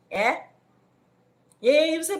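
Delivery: normal talking voice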